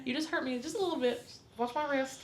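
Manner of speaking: high gentle explaining voice; gentle to the child. Retold or imitated. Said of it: "You know, like".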